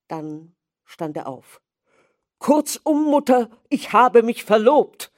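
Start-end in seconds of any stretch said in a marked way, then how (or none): 2.4-5.1 high, loud blurted announcement; blurted shout. Acted. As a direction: shout it all out at once. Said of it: "schrie er heraus".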